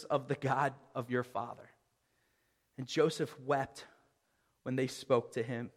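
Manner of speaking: soft, hushed reading from the Bible; preacher softly reading the scripture aloud. Acted. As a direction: let the verse settle, read it quietly. Reads the scripture passage.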